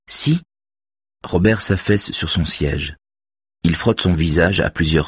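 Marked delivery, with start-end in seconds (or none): 0.1-0.4 sharp contradiction. A narrator's reading.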